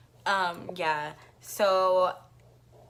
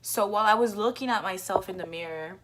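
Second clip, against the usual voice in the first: usual speaking voice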